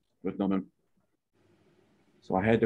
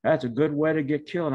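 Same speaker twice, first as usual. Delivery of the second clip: retold angry cussing; 6 semitones higher than usual. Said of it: "he kept cussing and going".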